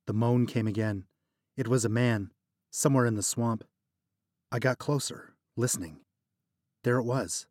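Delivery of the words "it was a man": usual speaking voice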